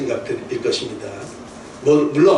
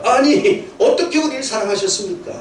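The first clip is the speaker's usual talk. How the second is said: high, protesting question; indignant retort; 8 semitones higher than usual. Retold or imitated. Preacher voices Israel's protest against God, indignant and high. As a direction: push back like an offended child. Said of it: "이스라엘 백성이 하는 말이죠".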